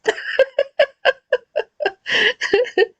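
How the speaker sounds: long, high, helpless real laugh; high burst of laughter. Real, not acted. Bursts out laughing after story.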